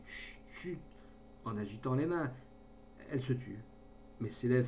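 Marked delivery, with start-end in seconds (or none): 0.1-0.8 soft unvoiced chut chut; whispered hush. A narrator's reading. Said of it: "Il lui murmurait".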